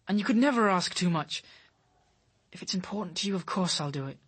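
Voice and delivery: normal talking voice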